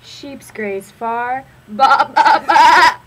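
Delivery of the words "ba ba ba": loud bleating sheep; sheep baa. Acted. Animal sound in kids' book.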